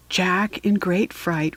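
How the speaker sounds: ordinary delivery, nothing marked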